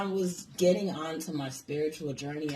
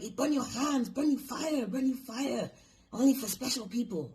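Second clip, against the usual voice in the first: mock Chinese accent, pitched much higher, broken English; retold Chinese accent, higher and insistent; imitated Chinese accent; 7 semitones higher than usual. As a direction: do the shop lady's Chinese accent warning you off. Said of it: "talking in fucking broken English".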